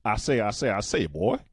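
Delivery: hammed-up Southern cartoon bluster; Foghorn Leghorn. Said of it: "foghorn leghorn".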